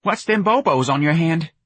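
high-pitched nosy mother; high voice, folksy Southern mom; fretful mother. Acted. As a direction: ask like a puzzled, homely mother.

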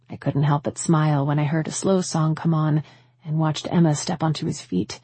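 ordinary delivery, nothing marked

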